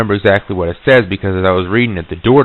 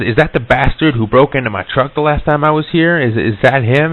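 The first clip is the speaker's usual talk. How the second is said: higher-pitched, mildly heated questioning; retold indignant demand; 7 semitones higher, 4 dB louder than usual. Asking the local about the truck break-in, indignant. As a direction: demand an answer, pitch raised.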